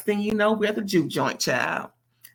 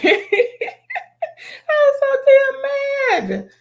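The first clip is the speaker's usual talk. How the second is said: much higher and louder, slow, laughing through it; laugh-cry the words in disbelief; laughing, half crying; 6 dB louder, much slower than usual. Real, not acted.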